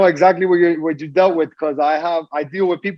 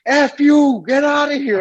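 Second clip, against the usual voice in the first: high, loud mock yell; girl's mock shout; 9 semitones higher, 4 dB louder, slower than usual. Homeless woman rejecting his food, retold loud yell. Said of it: "one girl's like".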